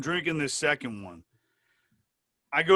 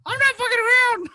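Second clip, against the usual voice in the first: very high, loud falsetto warning; furious little cartoon-mouse squeal; Mickey Mouse; 9 dB louder than usual. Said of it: "You sound like fucking Mickey Mouse".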